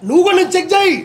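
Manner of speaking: loud indignant dare; loud challenge. Real, not acted. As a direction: throw it back at the reporter loudly. Challenging reporter about drinking.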